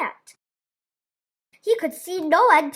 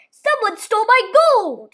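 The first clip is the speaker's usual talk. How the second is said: loud, higher furious giant roar; 6 semitones higher, 6 dB louder than usual. A narrator's reading. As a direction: roar it in outrage. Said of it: "he roared".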